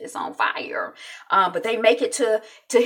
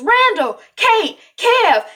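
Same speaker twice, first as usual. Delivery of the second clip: high, loud acted calls; calling out; 12 semitones higher, 8 dB louder, much slower than usual. Jack calling the kids during a fire, urgent shouting. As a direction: imitate a dad yelling for his children. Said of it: "And then he calls the kids".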